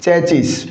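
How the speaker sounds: चैचिस slowly, with "ch" and a final s; mispronunciation. Shown as a demonstration. Teacher repeating the form to avoid, चैचिस as "chai-chis". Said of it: "न कि दोस्तों चैचिस".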